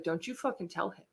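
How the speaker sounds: hushed warning. Retold or imitated. Mom warning her daughter, quiet and stern. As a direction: mom hisses a quiet threat.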